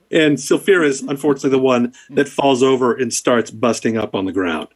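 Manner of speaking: normal talking voice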